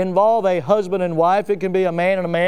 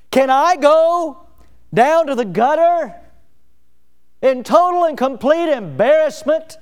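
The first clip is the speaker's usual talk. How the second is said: higher and louder, slowed, full-force preaching; preaching crescendo; 7 semitones higher, 4 dB louder than usual. Real, not acted. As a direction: build to the peak of the sermon. Preacher's climactic question.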